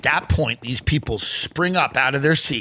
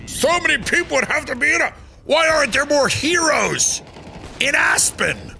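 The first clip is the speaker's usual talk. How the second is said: exasperated rant; 11 semitones higher than usual. Acted. Ranting about rich not being heroes.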